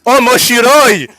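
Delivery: slow, loud mock Japanese gasp; excited anime fan.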